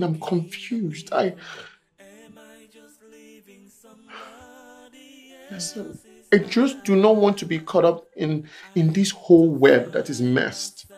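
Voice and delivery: very soft, low, defeated; broken and hushed. Acted. Son confesses to his mother, quiet and defeated. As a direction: let it drain out of him.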